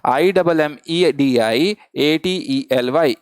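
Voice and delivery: spelled out slowly letter by letter; slow, deliberate letter-by-letter spelling. Shown as a demonstration. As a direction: dictate each letter, class repeating. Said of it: "फिर से बोलिए मेरे साथ".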